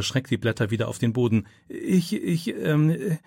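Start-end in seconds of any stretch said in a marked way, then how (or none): 1.7-3.2 frightened halting stammer, pitched up. A narrator's reading. Philipp caught by the teacher, stammering in fright. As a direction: stumble over the words, caught red-handed. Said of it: "stammelt er".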